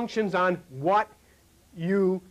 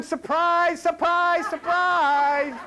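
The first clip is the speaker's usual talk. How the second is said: playful surprise reveal; 5 semitones higher, much slower than usual. Retold or imitated. Drag queens reveal themselves.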